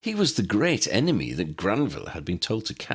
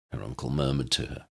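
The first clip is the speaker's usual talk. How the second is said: usual speaking voice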